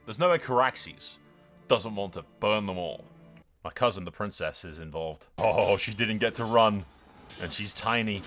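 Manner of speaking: usual speaking voice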